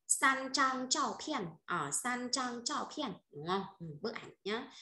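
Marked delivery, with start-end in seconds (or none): 0.1-3.1 "San trang chao pian" drawn out slowly, syllable by syllable; slow Chinese model reading. Shown as a demonstration. Teacher demonstrating measure word to students, slow and deliberate. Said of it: "sẽ đọc là gì đây".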